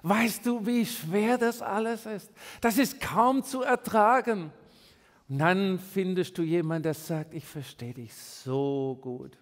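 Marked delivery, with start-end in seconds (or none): 0.0-4.5 mock lament. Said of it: "Und dann sind wir gerne im Jammern". 7.3-9.4 lower, softer, slow consoling; mock sympathy. Friend consoling the complainer, lower, soft and slow.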